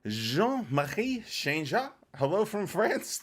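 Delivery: badly faked French accent, drawn-out name; playful mock-French pronunciation. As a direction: greet them in a hammy French accent. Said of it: "That was the worst accent you've ever heard".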